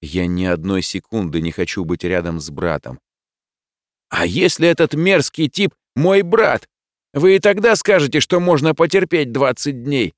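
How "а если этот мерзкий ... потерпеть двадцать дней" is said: high, agitated challenge; heated protest. A narrator's reading. Boy reveals the hated worker is his brother, indignant. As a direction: throw it at him, pitch raised throughout.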